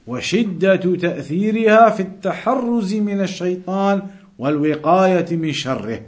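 ordinary talk

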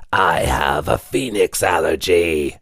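slow, lightly rasped ghoul voice; slow and dry, a throat full of sand; rasping skeletal customer. A narrator's reading. Customer refusing the delivery bag, slow light rasp. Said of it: "His voice rasped as if he'd swallowed a pound of sand".

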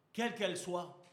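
ordinary talk, nothing marked

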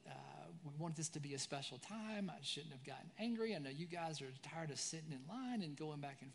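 soft, tender apology; father softly says sorry to his sons; gentle apology. Retold or imitated. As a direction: make peace with the boys quietly. Dad apologizing to tired sons.

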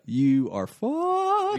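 ominous. Retold or imitated. Mock doom for Maria.

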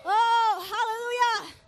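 a loud cry of praise to the congregation; shouted hallelujah. Real, not acted. Preacher crying out to the church, a loud shout. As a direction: let the hallelujah burst out loud.

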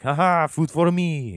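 high, slow, gleeful French caricature; mock French delight. Comic imagines the French greeting raining frogs, gleeful French caricature. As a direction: crow it loudly as a delighted cartoon Frenchman. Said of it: "if it were the French".

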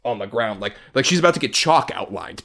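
normal talking voice

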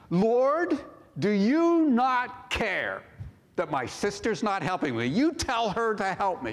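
accusing complaint. Acted. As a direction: wail it like an indignant accusation. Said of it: "was accusing the good shepherd".

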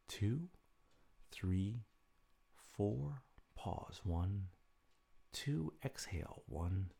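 soft, slow counting of breaths; guided breathing count. Acted. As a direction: count the breaths calmly and softly.

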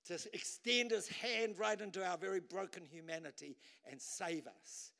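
ordinary talk

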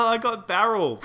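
laughing through the words. Real, not acted. Joking about grape juice.

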